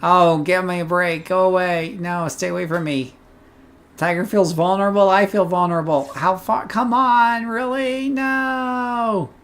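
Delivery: high, loud panicked pleading; panicked yelling. Real, not acted. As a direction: yell at the monster to go away. Still chased by monster.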